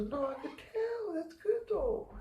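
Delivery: a calm, kind manager reassuring him; gentle manager voice. Retold or imitated.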